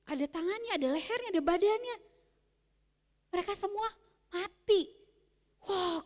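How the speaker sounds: plain reading voice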